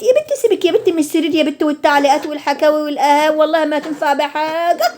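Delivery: lightly voiced scolding neighbour; mock scolding auntie. (تقليد خالة بتزعق بهزار)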